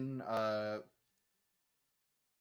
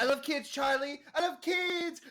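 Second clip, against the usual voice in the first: high excited cartoon voice; 13 semitones higher, 4 dB louder than usual. Quoting a Smiling Friends clip.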